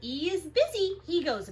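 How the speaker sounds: lively picture-book read-aloud; reading to children. Acted. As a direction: read it big and bouncy for little kids.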